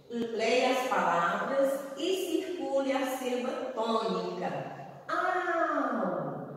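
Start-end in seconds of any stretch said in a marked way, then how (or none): 5.1-6.6 AU held long and high; stressed syllable drawn out. Shown as a demonstration. Teacher showing children the strongest syllable, stressed and drawn out. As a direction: stretch out "Au" so its strongest sound rings. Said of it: "qual é a mais forte".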